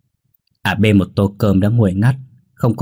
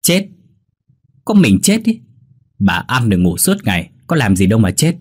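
snappish anger; 3 semitones higher than usual. Acted. Wife snapping at husband about his mother, cross. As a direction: snap back with scornful disbelief. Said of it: "Nhi nhăn mặt cáu".

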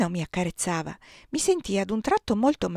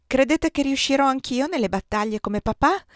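higher-pitched called question; shouting; 6 semitones higher, 4 dB louder than usual. A narrator's reading. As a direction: call it over eagerly as the boy. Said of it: "gridò Oscar attraverso il praticello". (domanda gridata, voce più acuta)

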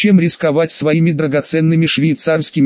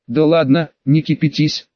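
ordinary delivery

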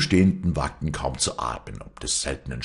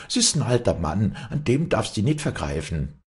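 higher-pitched motherly appeal; placating mother; 6 semitones higher than usual. A narrator's reading. Mother pleads for the old man, placating. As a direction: appeal to him to spare an elder. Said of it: "begütigte die Mutter".

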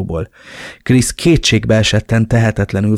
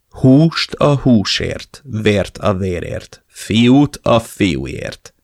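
slow menace; much slower than usual. Acted. Villain's ominous message.

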